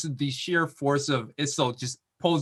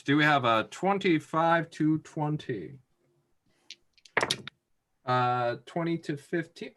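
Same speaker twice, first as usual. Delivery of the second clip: usual speaking voice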